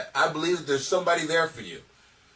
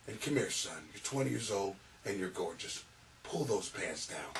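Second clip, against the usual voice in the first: much lower, much softer seductive murmur; creepy priest; 10 semitones lower, 15 dB quieter than usual. Acted. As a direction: lure him in low and soft like a predator priest. Predatory priest mock voice.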